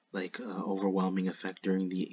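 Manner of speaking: ordinary talk, nothing marked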